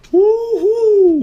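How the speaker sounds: very loud, very high whoop; cheering. Acted. Will cheering the rescue, loud whooping. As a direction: whoop with joy. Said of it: "Will cheered".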